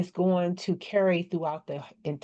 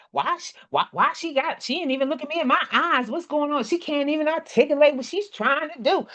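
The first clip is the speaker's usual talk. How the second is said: higher, puzzled listener complaining; confused listener; 8 semitones higher than usual. Retold or imitated. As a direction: grumble like a baffled audience member. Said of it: "y'all be like".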